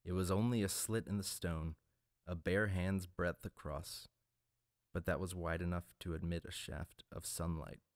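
ordinary delivery

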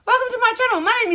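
high, quick, perky channel welcome; bright restart. Real, not acted. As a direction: snap back into host mode, fast and bright.

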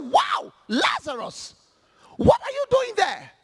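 calling out in surprise. Acted. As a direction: shout to Lazarus far away, amazed. Rich man calls from hell.

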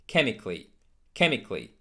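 CHEMICALLY in three syllables, "KEM-ik-lee"; correct chemically. Shown as a demonstration. Teacher modelling chemically like physically, A left silent. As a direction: drop the A after C in "chemically". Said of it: "In the same manner".

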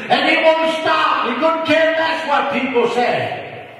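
high, fervent preaching, pushing hard; preaching at full force. Real, not acted. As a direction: preach it out to the congregation.

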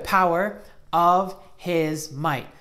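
drawn-out verse ending; very slow recitation. Shown as a demonstration. Teacher finishing the verse slowly for children. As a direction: stretch the words out slowly. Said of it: "Let's try saying it again".

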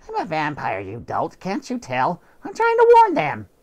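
snooty cat voice rising into a high loud cry; scornful cat, exasperated at the dolt; indignant cat ending in a shriek; haughty cat. Acted. Cat insists he is vampire.

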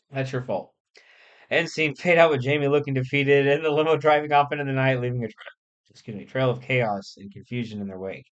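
ordinary delivery

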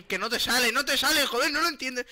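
high, loud insistence; exasperated outburst. Real, not acted. Player fed up with Javi's imitating, exasperated outburst. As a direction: scold in loud frustration.

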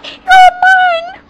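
very high, loud triumphant shout; triumphant cry. A narrator's reading. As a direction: seize her with a cry. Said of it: "grab her foot and cry".

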